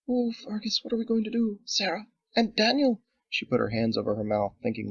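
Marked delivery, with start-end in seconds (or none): 0.1-3.0 higher, breathless panicked whisper; panicking wife fearing for her children; frightened wife. Acted.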